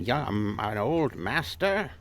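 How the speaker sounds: put-on slow, drawn-out old master voice. Guest trying out a Master Splinter voice, slow old master. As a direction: draw it out like an aged teacher. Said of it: "I could do".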